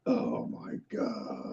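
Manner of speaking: breathy laughing oh my God; laughing whisper. Retold or imitated. Host retelling his reaction, laughing under breath. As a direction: whisper it slowly through laughter.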